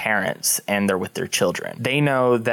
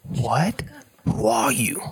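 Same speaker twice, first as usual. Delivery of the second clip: whispering; slower than usual. Acted. Prisoner whispers through cell bars.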